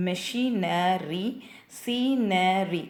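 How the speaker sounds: மெஷினரி and சீனரி slowly split into syllables; slow teaching demo of each syllable in மெஷினரி, சீனரி. Shown as a demonstration. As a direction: sound out மெஷினரி, சீனரி with the last three syllables separated. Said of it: "three syllables வந்து ரைமிங்காக வருது".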